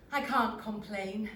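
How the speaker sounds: ordinary delivery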